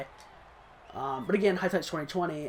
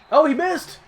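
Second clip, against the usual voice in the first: much higher and louder, delighted; excited surprise; 10 semitones higher, 11 dB louder than usual. Real, not acted. Gamer watching the kicker miss, thrilled yelp. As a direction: blurt out the good news. Kicker misses long field goal.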